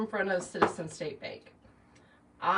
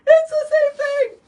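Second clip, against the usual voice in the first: much higher, much louder sobbing cry; retold crying wail; 16 dB louder than usual. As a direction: cry it out in a panic. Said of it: "and I'm crying".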